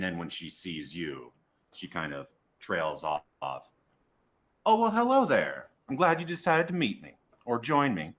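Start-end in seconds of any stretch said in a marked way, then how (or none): 4.6-8.1 high, loud greeting in character; big drawn "oh, hello there" from a performer; musician's greeting. Acted. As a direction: hail new company in a lifted, louder voice. GM voices the musician greeting the player.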